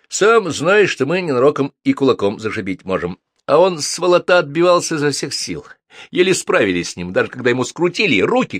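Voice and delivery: put-on rough young thug. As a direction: boast like a hired muscle guy reporting back. Narrator voices thug reporting. (грубоватый голос громилы, громче и выше)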